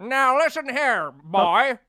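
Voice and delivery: high, loud, drawn-out scolding; stern old-time father. Acted. Old-time man scolding another's kid, stern father voice. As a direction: lay down the law like a vintage dad.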